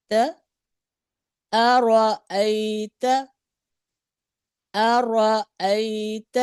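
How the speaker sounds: أرأيت very slow, the hamza eased softly; soft hamza recitation. Shown as a demonstration. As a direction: draw out أرأيت gently, no hard catch. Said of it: "नरमी से पढ़ा गया।".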